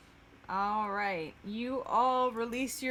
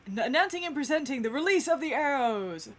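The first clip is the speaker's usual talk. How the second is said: grand announcement; 3 semitones higher, 4 dB louder than usual. Acted. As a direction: announce the speaker like a herald to a crowd. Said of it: "and goes".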